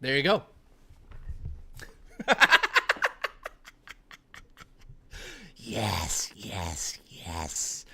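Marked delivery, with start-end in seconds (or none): 2.2-3.5 high villain cackle; evil cackle. Pretend. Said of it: "Little did you know I'm evil". 5.6-7.8 low, gloating villain hiss; evil villain voice. Pretend. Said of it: "Little did you know I'm evil".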